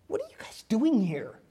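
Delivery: soft, understated exasperation; angels with attitude. Acted. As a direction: ask it softly, as mildly put-out angels. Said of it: "hear the attitude of the angels".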